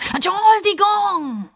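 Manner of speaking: very high, slow scared squeak; frightened squeak. A narrator's reading. Crocodile apologizes to old man, frightened squeak. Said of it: "quiekt der Justus verschreckt".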